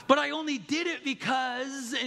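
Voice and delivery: high, protesting mock excuse; excuse-making. Apologizing spouse starts justifying himself, high excuse-making protest. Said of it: "you gotta leave the "but" and the "because".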